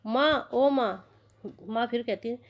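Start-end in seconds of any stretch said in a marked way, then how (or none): 0.1-1.1 slow, drawn-out groaning call; moaning in pain. Acted. Mohan calls his mother through stomach pain, slow drawn-out moan. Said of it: "दर्द का मारा करा रहा है".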